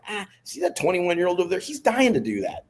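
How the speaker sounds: high-pitched, hammed-up passing the buck; mock reluctance. Older stuntman deflecting a dangerous job onto a youngster, mock-reluctant.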